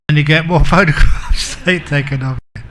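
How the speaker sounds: words broken up by chuckles; laughing. Real, not acted. Speaker joking to the audience about being photographed, laughing. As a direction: laugh your way through the punchline. Laughs through his own joke.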